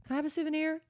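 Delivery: put-on high, soft child voice; little kid wheedling for a treat; pleading kid. Begging kid voiced by parent.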